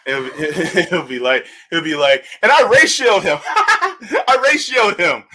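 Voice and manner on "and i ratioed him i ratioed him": giggling through the words; imitating Nick laughing at his own comeback; laughing while boasting. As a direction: crack up mid-brag. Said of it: "He be cracking up".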